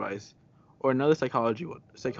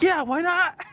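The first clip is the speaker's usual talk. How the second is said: imitating a gushing, eager yes, high-pitched and louder; very excited; 6 dB louder, slower than usual. Innocent friend answering guys who invite her to their room, very excited. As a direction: squeal an oblivious, excited agreement like your friend. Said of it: "she'd be, like, very excitedly".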